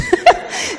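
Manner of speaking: a real, high laugh bursting out; high burst of laughter. Real, not acted. Telling the false teeth story, high real laugh. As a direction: let a high laugh break out.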